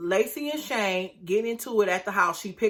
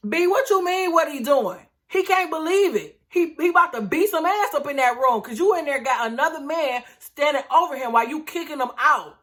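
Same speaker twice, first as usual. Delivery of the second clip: higher and louder in disbelief; indignant rant; 6 semitones higher, 4 dB louder than usual. Real, not acted. Vlogger angrily answering Lacey's quote to viewers, high indignant rant. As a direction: fire back, incredulous and heated. Ranting back at Lacey's words.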